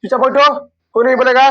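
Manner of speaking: loud and high, firm command; stern classroom order. Real, not acted. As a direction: silence the noisy class. Teacher silencing noisy class. (कक्षा को डाँटते हुए ज़ोर से)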